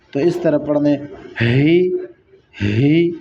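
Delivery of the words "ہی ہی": ہی with a breathy ح sound from the chest. Shown as a demonstration. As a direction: push ہی out from the chest. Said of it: "سینے سے آپ کی آواز نکلے". (سینے سے ہی)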